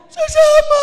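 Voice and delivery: high shrill shout; mock wailing sister. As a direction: shriek it like the grieving sister. Sister answers uncle. (pabirong matinis na sigaw)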